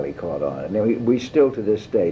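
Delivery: usual speaking voice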